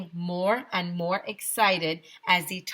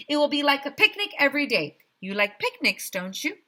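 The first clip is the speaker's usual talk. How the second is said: cheery mayor; 8 semitones higher, faster than usual. A narrator's reading. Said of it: "said Mayor Hubble".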